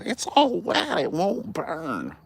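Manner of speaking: high whiny complaint; high, slow whine about the wet wood; whiny complaining. Retold or imitated. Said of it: "and go".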